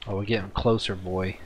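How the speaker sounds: usual speaking voice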